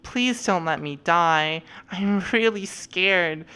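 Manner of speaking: drawn-out, hammed-up cry for help; slow, pleading mock fright; mock scared plea. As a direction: play up being helpless and in danger. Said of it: "have a safety vest on and be like".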